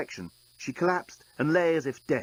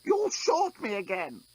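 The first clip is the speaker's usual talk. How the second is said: choking; 9 semitones higher, slower than usual. Acted. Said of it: "choked the despot".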